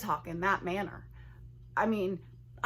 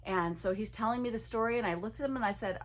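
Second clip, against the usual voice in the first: ordinary talk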